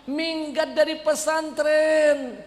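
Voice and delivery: high, loud, slow whiny fake sob; fake crying. Son fake-crying to his mother, high and loud. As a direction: whine like a crying kid.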